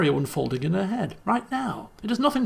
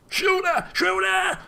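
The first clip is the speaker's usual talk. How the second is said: high yelled command; real panicked shout, much higher; 12 semitones higher, slower than usual. Real, not acted. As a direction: yell it at the screen. Player yelling at screen.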